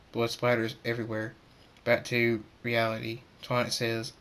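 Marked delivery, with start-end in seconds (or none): none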